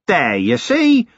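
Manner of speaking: slow, crowing triumph; triumphant. A narrator's reading. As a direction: gloat at being vindicated. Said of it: "said Flutter triumphantly".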